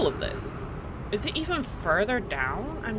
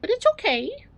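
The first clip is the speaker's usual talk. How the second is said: cooing pet talk; 7 semitones higher than usual. Real, not acted. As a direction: coax the cat up for cuddles. Invites cat for cuddles.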